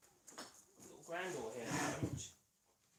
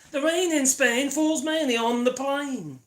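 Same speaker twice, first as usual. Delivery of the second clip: slow, loud, high posh elocution line; elocution exercise; 6 semitones higher, 11 dB louder, slower than usual. Pretend. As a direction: declaim it like a drama teacher. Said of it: "Can you hear my beautiful voice?".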